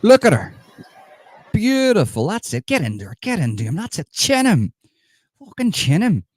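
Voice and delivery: high, excited egging-on with swooping emphasis; gleeful cheering. Acted. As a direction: cheer them on with delight, pitch leaping up.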